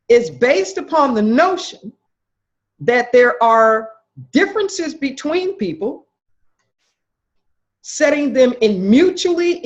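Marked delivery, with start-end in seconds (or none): none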